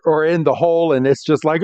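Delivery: ordinary talk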